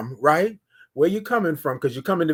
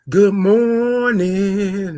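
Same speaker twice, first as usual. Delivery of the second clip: drawn-out greeting; 3 semitones higher, 5 dB louder, much slower than usual. Shown as a demonstration. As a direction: draw the good morning out long. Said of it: "Let me say it one more time".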